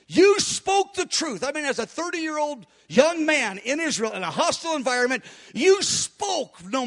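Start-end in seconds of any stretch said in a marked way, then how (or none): 0.1-1.4 higher, slow and heartfelt; fervent prayer. Shown as a demonstration. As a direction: lift it up in praise. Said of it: "I'll say to him".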